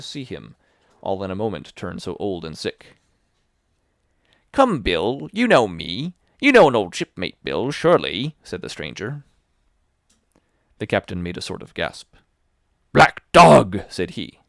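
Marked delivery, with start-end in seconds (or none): 4.5-8.4 loud coaxing plea; wheedling sea dog. Acted. Old shipmate pleading to be known. 12.9-13.8 startled loud cry of the name; shocked cry. Acted. Captain gasps on seeing him.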